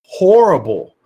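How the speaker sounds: ordinary delivery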